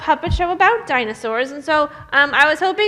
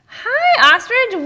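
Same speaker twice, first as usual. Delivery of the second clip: much higher and louder; excited hi; 8 semitones higher, 3 dB louder, much slower than usual. Acted. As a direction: greet cheerfully. Greeting the ostrich.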